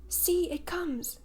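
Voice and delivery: high, slow pointing shout; excited cry. A narrator's reading. Curly pointing the boys to the bird overhead, excited. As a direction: call the others to look up. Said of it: "cried Curly".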